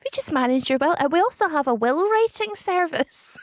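salesperson voice. Acted. Playing a salesperson.

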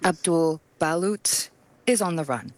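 ordinary talk, nothing marked

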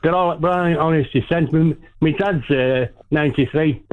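caller hamming up a bad Cockney accent; a fake Cockney caller, poorly done. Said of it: "your Cockney accent is as bad".

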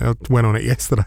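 ordinary talk

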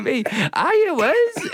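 high, laughing, exasperated "it was"; retold insistence. Comedian insisting to a Boston fan, exasperated. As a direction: plead in disbelief that he's doubted.

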